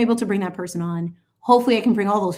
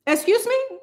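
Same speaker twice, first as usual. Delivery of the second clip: indignant excuse me; 13 semitones higher than usual. Pretend. Indignant reaction.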